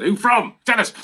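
loud, insistent demand. Retold or imitated. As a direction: press her for the answer. Pressing the editor for the name.

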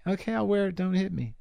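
a high, meek "okay, I'll wear it"; timid surrender. Retold or imitated. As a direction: shrink and beg not to be hit. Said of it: "I'd be the first guy".

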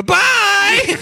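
high, loud call from afar; from another room. Acted. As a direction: shout it from out of sight. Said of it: "you just hear a voice go".

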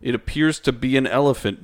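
ordinary delivery

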